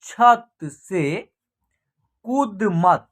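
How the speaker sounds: whole words, slowly stretched out; slow direct reading. Shown as a demonstration. Teacher showing how to read sentences directly, slow and measured. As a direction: read word by word, holding the long vowels. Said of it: "direct इसका उच्चारण होगा".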